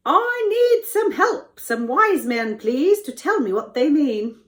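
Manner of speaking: high, loud, dramatic appeal; Pharaoh pleading. Acted. Pharaoh needs wise men to explain dreams, loud pleading. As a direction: cry out for help as a troubled ruler.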